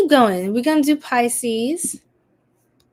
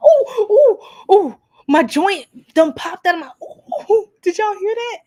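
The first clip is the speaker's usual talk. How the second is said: high, loud startled outburst; startled yelps; 9 semitones higher, 7 dB louder than usual. Real, not acted. Streamer asking if viewers heard her joint pop, startled. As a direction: cry out, half laughing, at a popped joint.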